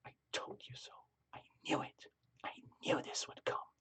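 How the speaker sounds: whispered, to oneself; private whisper. Retold or imitated. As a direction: whisper it only for yourself. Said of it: "very private whisper to, to yourself".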